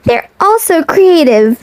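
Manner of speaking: ordinary delivery, nothing marked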